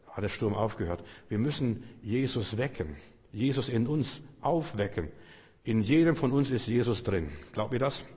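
usual speaking voice

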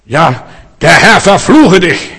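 slow, forceful imitated curse; mock angry curse. Believer cursing the sister God put in the way, with mock fury. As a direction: hurl the curse out, each word drawn out.

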